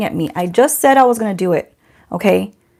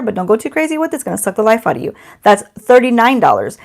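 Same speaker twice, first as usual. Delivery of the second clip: ordinary talk, nothing marked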